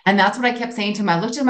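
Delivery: usual speaking voice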